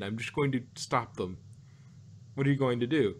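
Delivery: usual speaking voice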